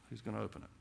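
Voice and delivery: hushed, lightly voiced Father calling out the question; a hint of the Father asking softly who can open it. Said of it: "holds the scroll out and says".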